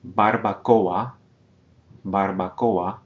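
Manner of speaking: Barbacoa slow, with o and a split; syllables separated. Shown as a demonstration. Teacher models Barbacoa slowly, vowels split. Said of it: "separating that vowel combination".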